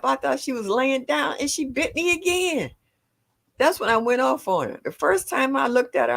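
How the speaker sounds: high-pitched, insistent complaint; indignant recounting. Real, not acted. Nana telling Mike about being bitten twice, indignant.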